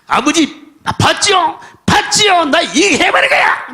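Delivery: high shouted taunt of a rebellious son; defiant son. Acted. (아버지를 약 올리는 반항아 아들, 높게 외치며)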